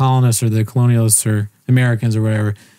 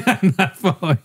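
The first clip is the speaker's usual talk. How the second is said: higher-pitched, laughing through the words; 6 semitones higher than usual. Real, not acted. Laughs at his own confusion.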